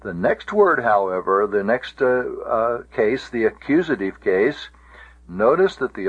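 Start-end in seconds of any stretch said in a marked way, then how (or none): none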